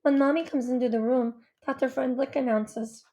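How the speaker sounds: ordinary delivery, nothing marked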